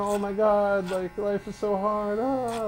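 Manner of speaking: mock whine. Mocking whiny crybaby attitude.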